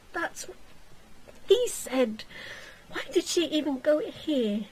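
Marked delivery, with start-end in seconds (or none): none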